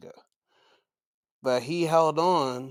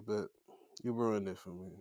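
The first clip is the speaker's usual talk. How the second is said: ordinary talk, nothing marked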